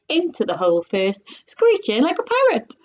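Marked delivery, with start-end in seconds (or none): 1.5-2.8 high-pitched narration; mock screech. A narrator's reading. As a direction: lift the voice high to mimic a screech. Narrator mimics the screech described.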